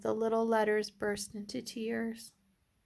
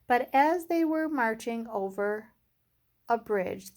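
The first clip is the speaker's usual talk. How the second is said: ordinary delivery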